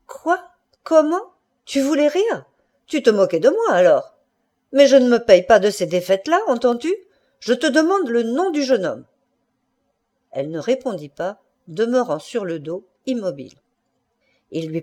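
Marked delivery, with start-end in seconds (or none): 0.1-9.1 high, indignant outburst; quivering with anger. A narrator's reading. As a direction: fire off outraged questions, voice raised. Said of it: "il frémissait de colère". (frémissant de colère)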